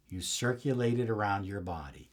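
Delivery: low, soft and unhurried; quiet voiced guidance, not yet a whisper; soft guiding voice. Shown as a demonstration. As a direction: ease the listener through it softly. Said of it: "And as you breathe in".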